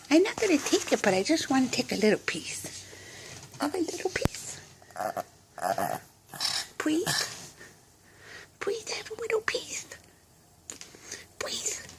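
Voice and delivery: soft, whispered begging; pleading whisper. Real, not acted. Owner begging her dog for a little piece, pleading whisper. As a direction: coax in a hushed, soft voice. Coaxing dog for cookie quietly.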